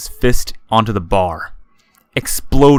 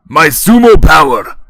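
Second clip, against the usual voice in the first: put-on very loud, slow, higher drunk; booming drunken voice; 7 semitones higher, 8 dB louder than usual. A narrator's reading. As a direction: boom a drunken threat. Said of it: "Shoji says".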